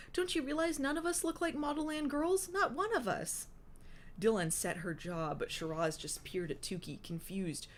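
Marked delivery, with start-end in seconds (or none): 0.1-3.5 high, pleading character voice; blurted protest. A narrator's reading. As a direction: plead with the squabblers in a raised pitch. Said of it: "Tukey blurted out, surprising even herself".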